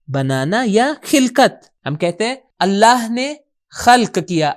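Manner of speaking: normal talking voice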